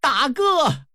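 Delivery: high theatrical cry of grief; loud cry. Acted. Second brother calls to slain eldest, high anguished cry. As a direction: wail out high to a fallen brother. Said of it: "大喊一声".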